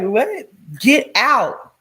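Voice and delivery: loud, high mock yell; shouting get out. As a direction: yell him out of the house. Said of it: "I was like".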